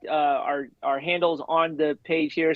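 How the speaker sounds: usual speaking voice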